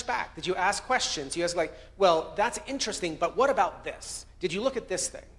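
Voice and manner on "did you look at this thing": ordinary talk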